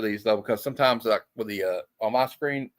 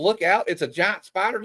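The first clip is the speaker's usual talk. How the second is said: higher-pitched retold warning shout; mock yell; 6 semitones higher than usual. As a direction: mildly imitate someone yelling a warning. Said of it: "did he really have to yell".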